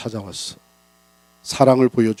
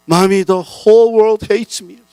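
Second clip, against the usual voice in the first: put-on upset child; 10 semitones higher, 7 dB louder, much slower than usual. Child runs crying to mother.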